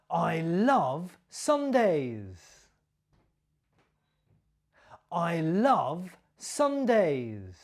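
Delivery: slow, with "Sundays" stressed and falling. Shown as a demonstration. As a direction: answer slowly, landing on "Sundays". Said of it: "my stress and my intonation".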